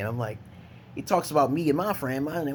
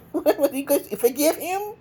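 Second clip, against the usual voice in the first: high, slow, laughing mockery; mock-crying squeal; 14 semitones higher, slower than usual. Making fun of the crying troll, high and laughing. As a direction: squeak it out through giggles, stretched.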